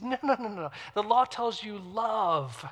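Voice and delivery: high, slow, emphatic no's; Jesus correcting. Retold or imitated. Jesus answers the Pharisees, slow emphatic correction. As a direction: lean hard on love, slowly. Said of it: "And Jesus says".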